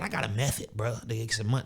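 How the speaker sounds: low, soft mock hustler voice; mocking a scammer. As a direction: drop low and imitate the method guy. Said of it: "don't nobody even talk like that".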